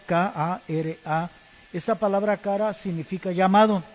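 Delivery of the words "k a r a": slow letter-by-letter spelling of "K A R A". Shown as a demonstration. Preacher spelling the Hebrew word for the congregation, slowly. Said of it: "Es, es K de kilo".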